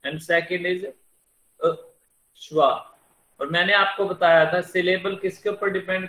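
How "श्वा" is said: ordinary delivery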